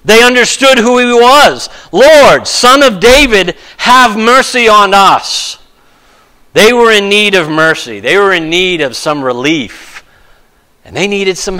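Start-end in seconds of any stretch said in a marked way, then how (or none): 1.9-5.6 crying out. Retold or imitated. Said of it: "what was it they shouted".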